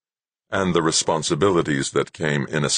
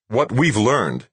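slow, higher, determined delivery; firm and deliberate, with raised pitch; 7 semitones higher, 3 dB louder, slower than usual. A narrator's reading. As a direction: say it as firmly as you can. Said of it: "in as firm a voice as he could".

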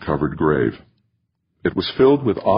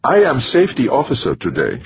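put-on higher-pitched Middle European accent; 8 semitones higher, 3 dB louder than usual. A narrator's reading. Safety officer announcing herself to a colleague, Middle European accent. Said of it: "in her Middle European accent".

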